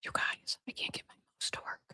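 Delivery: whispered confession to the viewers; a hushed aside to the audience. Real, not acted. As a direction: whisper it like a secret to the chat. Tells viewers mouse broke.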